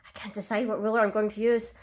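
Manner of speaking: usual speaking voice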